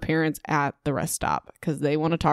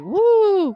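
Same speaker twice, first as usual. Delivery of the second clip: high, loud "wooo"; drawn-out high whoop; mock-excited "woo"; 5 dB louder than usual. Mock excitement at plot twist.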